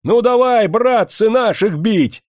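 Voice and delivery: high, loud rallying yell; shouted outcries. A narrator's reading. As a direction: holler to rouse the crowd. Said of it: "раздались выкрики".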